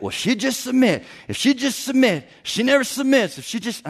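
hammed-up complaining husband; whiny husband. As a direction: mimic a grumbling husband, lightly. Husband complaining wife won't submit.

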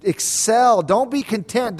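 normal talking voice

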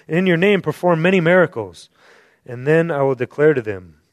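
loud, fast, driving scripture reading; preaching scripture. Acted. Preacher reads Jesus' warning to the congregation, loud. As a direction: thunder the verse out to the room.

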